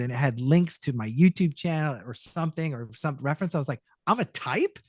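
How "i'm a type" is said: retold surprise. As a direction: react as if you can't believe you're a type. Surprised at being a type.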